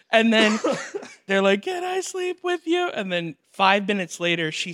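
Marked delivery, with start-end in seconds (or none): 1.6-2.9 put-on high, small child voice; pleading little girl. Imitating the daughter asking her parents, high pleading.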